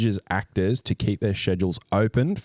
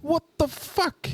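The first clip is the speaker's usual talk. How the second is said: exasperated disbelief. Real, not acted. Ranting about a bad movie.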